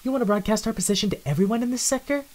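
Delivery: high, sharp whispered scold. Acted. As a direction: put him in his place.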